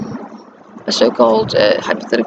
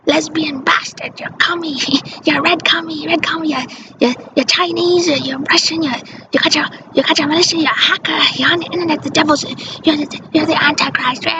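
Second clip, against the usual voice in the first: imitated ranting mother; 6 semitones higher than usual. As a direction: pile on the insults like a paranoid mother. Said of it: "She'd say".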